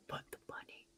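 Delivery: hushed, breathy mock fury at his kid; whispered rage from Nick Cage at his kid. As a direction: yell at the kid without making a sound. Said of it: "He's like".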